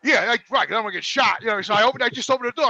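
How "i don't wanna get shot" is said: ordinary talk, nothing marked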